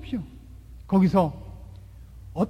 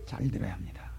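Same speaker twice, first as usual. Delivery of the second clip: whisper; 14 semitones lower, 11 dB quieter than usual. Real, not acted. Preacher to congregation about listening in class, whispered. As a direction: drop to a sudden whisper.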